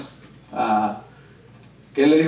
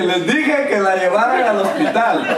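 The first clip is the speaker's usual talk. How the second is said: high, slow, laughing through it; laughing told-you-so; 8 semitones higher, 3 dB louder than usual. Retold or imitated. Pastor retelling his reply about the hospital, high and laughing. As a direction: tease with an I-told-you-so chuckle.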